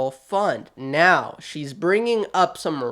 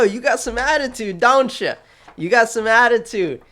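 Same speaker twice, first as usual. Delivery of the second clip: teasing; 6 semitones higher, 4 dB louder than usual. Real, not acted. Teasing council member about attitude.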